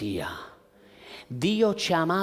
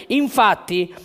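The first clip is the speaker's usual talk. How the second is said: normal talking voice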